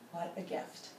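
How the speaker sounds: whispered, soft wonder; hushed awe. Real, not acted. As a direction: breathe it out softly after the sigh. Calm meditation after sigh.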